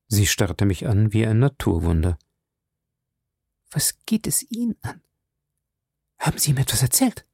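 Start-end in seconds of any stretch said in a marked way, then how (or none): 3.7-7.3 whispered, then quick anxious question; frightened whisper. Acted. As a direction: hush it, then rush the question. Ängstliche Fragen Violets. (ängstliches Flüstern, dann hastig)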